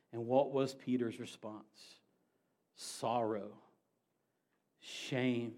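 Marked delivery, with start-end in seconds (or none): none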